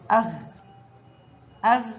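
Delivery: أغ closed on a throaty ghain; letter with sukun. Shown as a demonstration. Teacher shows ghain with sukun, أغ as a closed syllable. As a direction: say أغ as one syllable, no vowel after غ. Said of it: "حرف الغين فوقه سكون ينطق".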